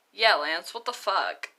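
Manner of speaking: Pidge blurts it out loudly; loud swearing outburst. Acted. Pidge mocking Shiro's language rule, loud cheeky outburst. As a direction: fire it back loud and cheeky.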